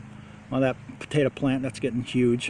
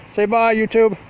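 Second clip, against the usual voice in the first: cheery sign-off; 7 semitones higher, 4 dB louder, slower than usual. Real, not acted.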